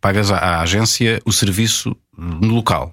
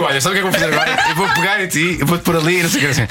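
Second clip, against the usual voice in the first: high, fast, laughing narration; mock bedroom commentary; 7 semitones higher, faster than usual. Shown as a demonstration. Demonstrating to guests how he narrates in bed, mock commentary. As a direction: rattle off each move in a raised voice. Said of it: "agora vou relatar".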